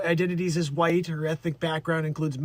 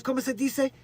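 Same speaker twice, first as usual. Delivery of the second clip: mock Spanish accent; 6 semitones higher than usual. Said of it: "She goes".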